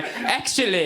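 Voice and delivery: put-on smug British accent, drawn-out correction; smug British man. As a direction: correct them with British smugness. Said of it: "a smug British man's voice".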